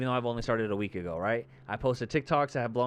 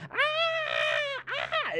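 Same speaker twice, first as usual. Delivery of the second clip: high shrieking scream from the fleeing witch; imitated witch scream. Mock scream of the witch chased by kids. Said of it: "I'm not even being dramatic".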